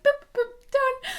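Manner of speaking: high, bouncy singsong; high playful singsong boop; chirpy boop. Retold or imitated. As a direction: pop each word up high and light. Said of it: "was like".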